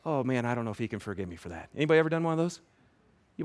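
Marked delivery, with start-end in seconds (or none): 0.1-1.7 low, hushed, quick self-doubt; worried murmur. Acted. Sinner doubts he can be forgiven, hushed and worried. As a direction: drop right down as if thinking aloud.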